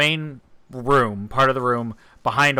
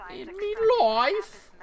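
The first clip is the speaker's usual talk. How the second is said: mock Irish accent; slower than usual. Mocking friend's phrasing.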